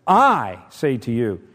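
slow, weighty "I"; emphatic. Retold or imitated. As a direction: stress on "I", loud and drawn out. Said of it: "making such an emphatic point".

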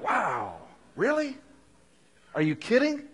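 high, sarcastic disbelief; mock amazement. Preacher parodying self-centred believer, mock amazed. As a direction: gush sarcastically at yourself.

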